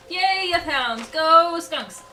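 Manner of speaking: mock cheer. Cheering for enemy monsters.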